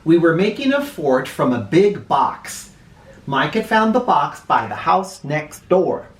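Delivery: plain reading voice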